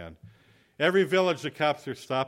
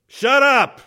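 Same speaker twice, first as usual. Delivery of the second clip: sudden high, very loud yell; exasperated shout; 13 semitones higher, 17 dB louder than usual. Retold or imitated. Guilty passenger to the driver staring at him, exasperated shout. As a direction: explode in hammed-up defensive outrage.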